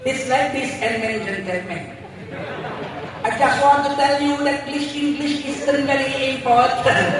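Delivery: English with a Madurese accent; English speech in a Madurese accent. Shown as a demonstration. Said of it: "pakai logat Madura".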